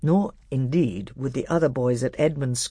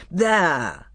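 higher, forceful snap of annoyance; growled; 5 semitones higher, 4 dB louder than usual. A narrator's reading. Scowling king flinging parchment at his lords, growled. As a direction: throw the word out crossly. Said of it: "he growled".

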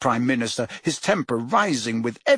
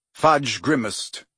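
usual speaking voice

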